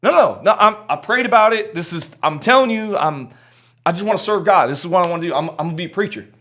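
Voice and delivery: high, loud, tumbling protest; acting out a worked-up young hopeful; insistent pleading. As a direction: plead your case, words piling up. Retold pleading with the pastor.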